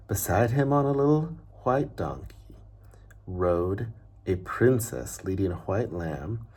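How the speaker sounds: even, unhurried reading-aloud voice; storybook narration. Acted. Teacher reading a picture book to children, story narration. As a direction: tell the tale like a bedtime story.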